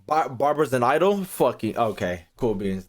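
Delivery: normal talking voice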